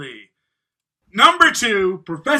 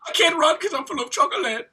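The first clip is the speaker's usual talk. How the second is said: high put-on German schoolboy accent; a German kid whining about chocolate; 9 semitones higher, 4 dB louder, faster than usual. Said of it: "Give us your best Germany accent".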